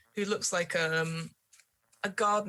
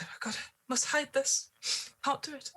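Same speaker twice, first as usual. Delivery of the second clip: high, frantic muttering servant; panicked woman; 8 semitones higher than usual. Acted. GM voicing the frightened servant burying the player, panicked.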